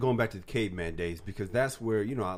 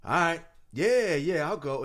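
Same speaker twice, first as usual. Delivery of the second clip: forced cheerful yes; 8 semitones higher, 6 dB louder, slower than usual. Retold or imitated. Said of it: "I was like".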